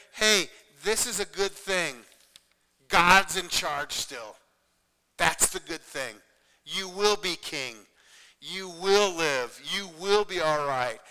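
slow, forceful encouragement; emphatic reassurance. Retold or imitated. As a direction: reassure a friend firmly, word by word. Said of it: "he said to David".

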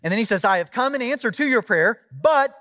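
impassioned preaching. Real, not acted. Preacher tells congregation of Daniel's wait, impassioned. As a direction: preach the Bible story with lifted energy. Preaches on the verse just read.

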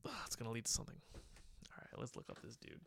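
hushed whisper, as if caught out. Real, not acted. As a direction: whisper it so nobody hears. Sneaking a look at his Twitter.

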